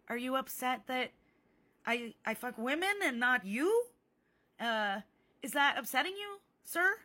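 sweetly goading, ending high on "sir"; mock concern. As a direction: needle him with fake sympathy. Acted taunt, mock concern.

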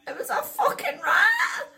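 high, loud tearful shriek; hysterical wail. Real, not acted. Telling viewers a rat touched her leg, hysterical wail.

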